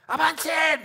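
high, sharp order to charge; command to advance. Acted. Spartan leader ordering his army forward, high commanding call. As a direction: send the troops forward.

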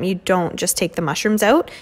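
ordinary talk

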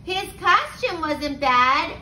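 usual speaking voice

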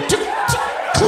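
high breathy hatchling coo; baby dragon cooing. Retold or imitated. Comic imitating her laugh as a hatching dragon, high coo. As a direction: squeak it out like a tiny dragon. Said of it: "You sound like a baby dragon hatching".

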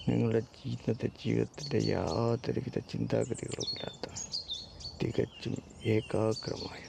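soft, unhurried meditation voice with long pauses; guided meditation. Acted. Leads a countdown meditation.

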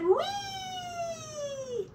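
very high tiny mouse squeal; squeaky mouse. Pretend. The mouse cries out in a tiny high squeal. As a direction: squeal it like a delighted little mouse.

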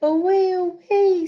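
high, loud cry of away; loud excited away. Acted. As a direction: call it out as the kite flies off. Kite blowing away, read aloud.

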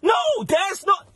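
frustrated shout. Acted. Frustrated outburst in argument.

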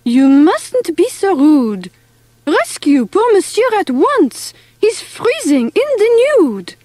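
high-pitched French-mouse reproach; scolding. A narrator's reading. Sister urging brother to rescue poor monsieur, scolding. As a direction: tell him off, pitch climbing with urgency. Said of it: "cried Eloise".

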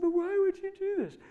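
high, squeezed tearful voice, imitated. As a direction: plead through mock tears. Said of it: "she started crying".

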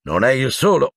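huffy. A narrator's reading. Minister grumbling about his own disappointment, huffing. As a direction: puff it out in annoyance. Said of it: "sbuffò Fudge".

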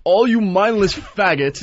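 high, slow mock outburst; hammed-up indignant rage; imitating angry rant. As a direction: mimic a furious man addressing the room. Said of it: "he goes like this".

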